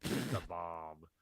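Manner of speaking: quiet mock-confidential aside; hushed aside. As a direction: drop to a low murmur, slowly. Aside about the bomb, retold.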